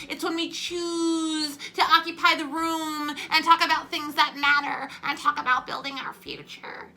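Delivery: high, loud, drawn-out rally speech; AOC. Acted. Mimicking a congresswoman addressing an audience, ridiculous speechifying. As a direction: stretch out the words like a rousing speech. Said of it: "AOC made this ridiculous speech".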